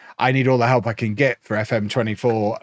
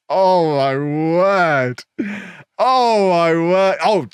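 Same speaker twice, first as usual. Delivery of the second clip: very slow, loud, higher disbelief; exasperated; 6 semitones higher, 4 dB louder, much slower than usual. Real, not acted. Reacting to a rival's boast, slow loud disbelief. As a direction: draw it out, lost for words.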